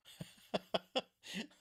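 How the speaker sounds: high, real chuckling; amused laugh. Real, not acted. Host unsure what an online article is called, laughing. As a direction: laugh at your own confusion. Laughing at his own confusion.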